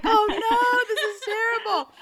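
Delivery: high, loud, mock wail; a kid wailing about her swollen lips; mock crying. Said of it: "I was like".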